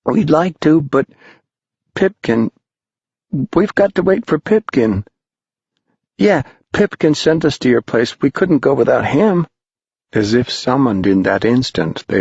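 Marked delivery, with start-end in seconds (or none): 0.1-2.5 put-on high, timid little boy's voice; peeping boy. A narrator's reading. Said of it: "Someone peeped". 3.6-9.5 put-on high-pitched protesting boy's voice; young boys. A narrator's reading. Boys answer the shrouded man.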